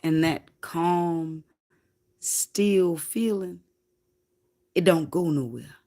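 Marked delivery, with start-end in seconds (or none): 0.0-3.6 slow calm. Retold or imitated. Describing calm of meditation.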